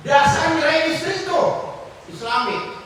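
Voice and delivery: high, loud preacher's outburst; preacher scolds wives who order husbands around, loud and high; fiery preaching. Acted. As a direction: thunder it at the congregation. Preacher rises to full force.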